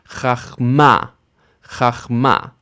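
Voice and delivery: "Chachma" with the weight on MA; final-syllable stress. Shown as a demonstration. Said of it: "the final syllable is stressed".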